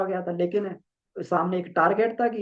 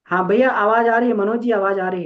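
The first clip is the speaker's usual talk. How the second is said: loud; 4 semitones higher, 8 dB louder than usual. Real, not acted. Answering a bad audio line.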